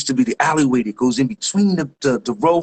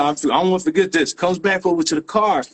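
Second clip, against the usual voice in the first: ordinary talk, nothing marked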